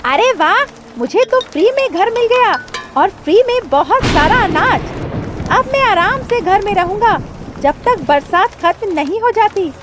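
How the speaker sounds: high, loud, gloating crow; happy crow. A narrator's reading. Crow enjoying a stolen house and grain, happy. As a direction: crow voice crowing with delight. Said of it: "Kalu कौवा अपने घर में खुश था".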